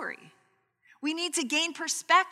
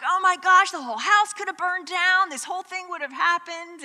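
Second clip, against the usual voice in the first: higher, louder mock panic of a father; a father panicking at the burnt bushes, higher and louder; dad freaking out; 7 semitones higher, 5 dB louder than usual. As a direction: play the dad's alarm, higher and louder. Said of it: "my dad, like, freaking out".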